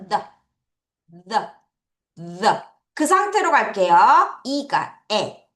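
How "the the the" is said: "The" with the tongue poking out between the teeth. Shown as a demonstration. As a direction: show the th sound slowly, three times. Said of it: "이 사이 여기다 빼는 거죠". (혀를 이 사이로 빼고 "The")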